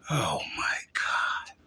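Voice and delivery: breathy, hushed oh my God, slow and soft; disbelieving whisper. Real, not acted. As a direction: let it slip out under your breath, stunned. Reacting in disbelief to a claim.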